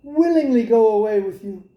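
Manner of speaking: put-on a young girl planning her escape eagerly; girl's voice. Rapunzel telling the king's son her plan, lightly girlish. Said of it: "She said".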